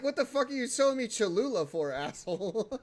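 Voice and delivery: loud mock-outraged yell; retold outburst. Telling his stream about the friend's hot sauce, mock yell. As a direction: bellow it, half laughing at the memory. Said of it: "I'm like".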